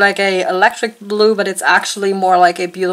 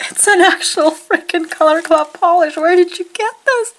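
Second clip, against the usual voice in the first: delighted; 8 semitones higher than usual. Real, not acted. Finds a rare polish in parcel.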